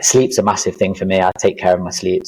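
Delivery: ordinary delivery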